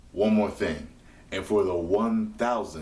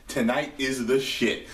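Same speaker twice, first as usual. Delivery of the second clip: normal talking voice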